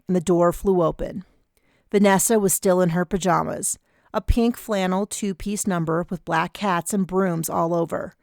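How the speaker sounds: usual speaking voice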